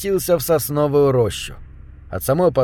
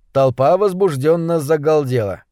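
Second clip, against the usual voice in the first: plain reading voice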